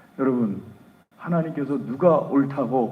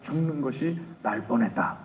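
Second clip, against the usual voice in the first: ordinary delivery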